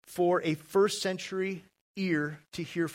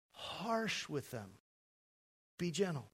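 gentle hush; 11 dB quieter than usual. Real, not acted. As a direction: let the voice fall away gently. Preacher urges gentleness, dropping voice.

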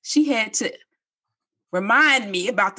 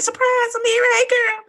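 very high gleeful hello; surprise greeting; 13 semitones higher than usual. Shown as a demonstration. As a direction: burst in high, greeting a girlfriend. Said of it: "Just like that".

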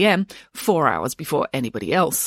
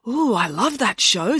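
slow, gushing, raised pitch; excited; 6 semitones higher, slower than usual. A narrator's reading. Boris hearing about the cooking show, excitedly. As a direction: gush with drawn-out delight. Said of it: "said Boris excitedly".

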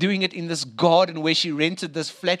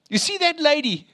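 ordinary talk

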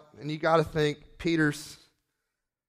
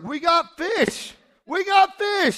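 high, loud, drawn-out yell; acted ecstatic fisherman's cry; excited shout; 8 dB louder, slower than usual. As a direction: holler to the other boat at full voice. Peter yells for help with catch.

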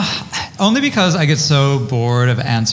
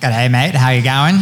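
put-on Australian accent; 3 semitones higher, 5 dB louder, slower than usual. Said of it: "you're so Australian".

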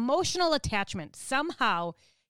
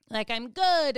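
ordinary talk, nothing marked

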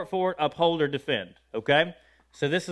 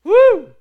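high, very loud woo; exultant whoop; 15 dB louder than usual. Real, not acted. Preacher exulting in the psalm, exultant whoop. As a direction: let out a sharp whoop of praise.

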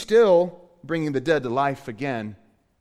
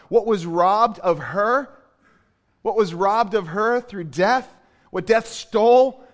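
louder, slowed emphatic preaching; impassioned preaching; 4 semitones higher, 6 dB louder than usual. Acted. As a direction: build the outrage at loss. Preaching at full force.

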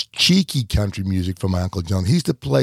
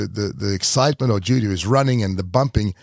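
usual speaking voice

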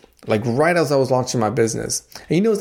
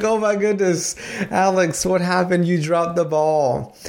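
high-pitched, playful exasperation, slowing at the end; a parent groaning in comic disbelief; mock dismay; 6 semitones higher, slower than usual. Dad dismayed at son's gaffe.

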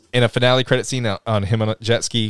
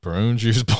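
ordinary delivery

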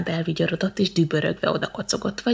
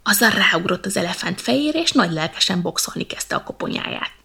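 usual speaking voice

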